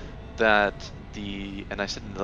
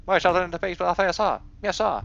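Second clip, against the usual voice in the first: higher, louder, fast soldier reply; eager soldier shout; 8 semitones higher, 9 dB louder than usual. Acted. Soldier answering the colonel with a fast, loud, eager shout. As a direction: snap it back like a keen recruit. Redoes soldier line more forcefully.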